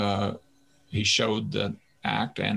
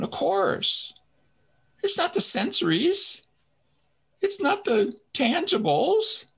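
very high, shrill incredulous squeal; 11 semitones higher than usual. Retold or imitated. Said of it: "I'm gonna get my shrill voice".

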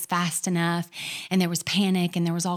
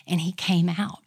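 ordinary talk

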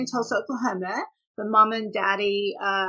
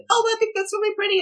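excited gush; 12 semitones higher, 6 dB louder, much faster than usual. Retold or imitated. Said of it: "it's like".

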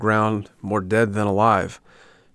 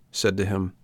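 ordinary delivery, nothing marked

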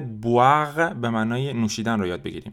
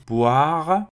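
repetition drill; much slower than usual. Shown as a demonstration. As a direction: clear conjugation read for repeating. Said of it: "بعد من تکرار بکنین".

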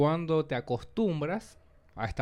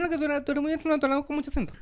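very high-pitched mock voice; imitación de interlocutor; 12 semitones higher, much faster than usual. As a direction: imitate someone pointing it out, way up high. Voicing people commenting on accent. (voz imitada muy aguda)

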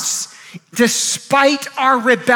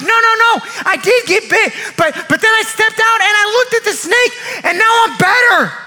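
excited testimony; 8 semitones higher than usual. Acted. Healed man tells tent-dweller how he recovered, excited testimony. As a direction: burst out with it, insistent.

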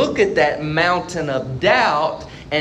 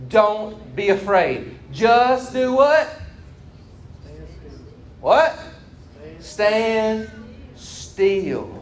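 raised, animated scripture reading; dramatic scripture reading; 6 semitones higher than usual. Acted. Preacher reading Exodus to the congregation, animated. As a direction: read the Exodus passage with rising drama.

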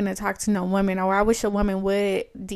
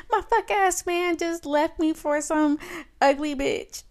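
put-on higher, slowed complaint of a dumped drunk girl; a lightly voiced tipsy whine; whiny drunk girl; 7 semitones higher, 4 dB louder than usual. Drunk girl to stranger about her man, mild whiny voice.

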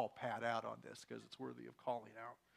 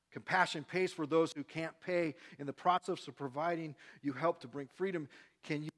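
preaching; 6 dB louder than usual. Acted. Preaching at full force.

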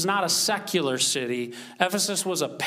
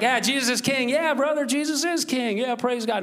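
much higher, breezy mock church cheer; throwaway enthusiasm; 8 semitones higher than usual. Pastor mocking a throwaway phrase between brothers, high and breezy. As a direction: rattle it off as an easy, upbeat slogan. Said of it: "not just a throwaway phrase".

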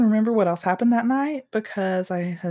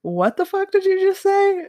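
high, louder, indignant snap at the man; outraged demand; 11 semitones higher, 4 dB louder than usual. Retold or imitated. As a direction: call him out, disbelieving and furious. Confronting the man who insulted her.

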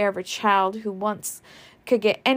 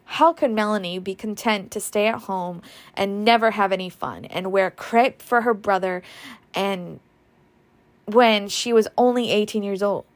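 usual speaking voice

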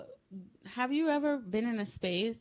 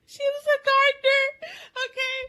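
high, loud, tearful laughing voice; helpless laughter; 7 dB louder than usual. Real, not acted. Telling co-host about gardener grandmother, helpless laughter. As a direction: squeeze the words out while cracking up.

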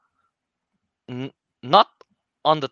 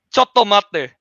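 quoted Japanese, higher and louder; Japanese pronunciation; 7 semitones higher, 8 dB louder, slower than usual. Retold or imitated. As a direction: call it out in Japanese like the rat sensei. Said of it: "in Japanese".

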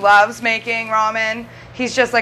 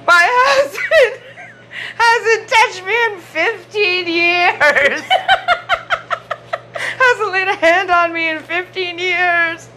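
high, slow, laughing-crying wail; mock sob through laughter; sobbing old lady; 6 semitones higher, slower than usual. Retelling the bitter old lady's outburst, hammed-up sobbing. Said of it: "and then she goes".

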